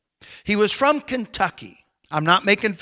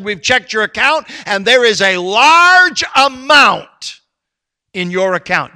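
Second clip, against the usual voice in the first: raised pulpit voice building to a loud climax; animated preaching; 4 semitones higher, 7 dB louder than usual. Acted. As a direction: preach the story, swelling on the key words. Preaches an illustration.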